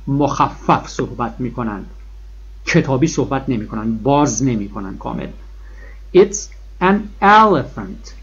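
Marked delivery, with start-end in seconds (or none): 6.2-8.1 an elephant stretched very slowly; slow model sentence. Shown as a demonstration. Teacher models the sentence again, slow and stretched. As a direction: draw out an elephant. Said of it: "چرا من دوباره ان به کار بردم".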